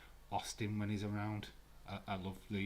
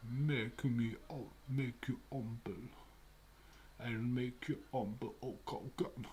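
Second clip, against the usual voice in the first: Iron Sheik impression; 3 semitones higher, slower than usual. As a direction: growl the threat like the Iron Sheik. Imitating the Iron Sheik on screen.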